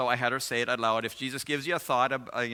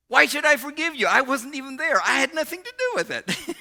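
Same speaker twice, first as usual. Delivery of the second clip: high, loud, exuberant disbelief; acted incredulous protest; 14 semitones higher, 7 dB louder than usual. Fiancé reassuring her he wasn't there, loud incredulous imitation. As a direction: imitate him bursting out in protest. Fiancé dismisses need to forgive.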